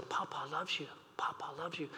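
soft, hushed grandpa coo; tender cooing. Retold or imitated. Grandfather cooing to grandchild on his lap, soft and tender. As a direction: murmur it to a baby on your lap.

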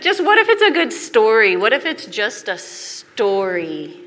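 wife defiantly repeating her doubt to husband; blurted out. Retold or imitated. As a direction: blurt it out, then drag the last line. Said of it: "And I said".

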